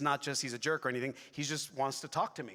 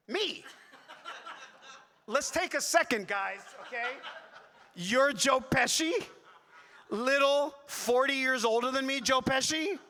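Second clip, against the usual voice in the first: high, loud, incredulous protest; comically offended, pitched way up; mock outrage; 10 semitones higher, 5 dB louder than usual. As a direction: repeat the insult back in loud disbelief. Mock outrage at mistaken likeness.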